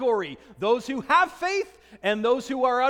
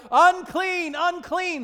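raised, higher warning call of unclean; crying out a warning; 6 semitones higher, 5 dB louder, slower than usual. Retold or imitated. Woman warning the crowd off, loud call. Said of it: "she would have to cry out".

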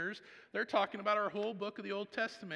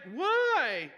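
much higher and louder, laughing; delighted why; 10 semitones higher, 11 dB louder than usual. Retold or imitated. Dad laughing back at his son, excited. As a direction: ask it full of delight.

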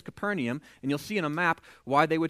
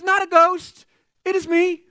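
high, loud call across water; acted calling out; 12 semitones higher, 11 dB louder, slower than usual. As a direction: announce yourself loudly to frightened friends. Jesus calls out to frightened disciples.

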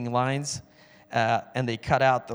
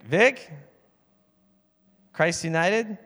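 higher, drawn-out disbelieving echo; mock puzzled, pitched up; incredulous question; 4 semitones higher, slower than usual. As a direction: imitate someone puzzled by the name. Retells the other man's puzzled reply.

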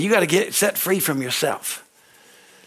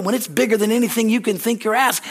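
normal talking voice

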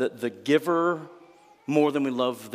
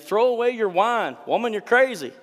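higher, louder mock rebuke; scolding; 9 semitones higher, 5 dB louder than usual. Priest Eli rebuking Hannah for drinking, mock angry scolding. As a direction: bark it like an outraged priest. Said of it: "he demanded".

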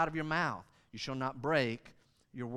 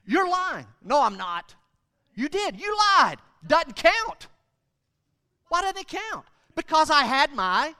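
high, loud playground argument; mock childish accusations and denials, pitched way up; squabbling kids; 11 semitones higher, 10 dB louder than usual. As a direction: bicker like indignant children. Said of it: "you know how we did it".

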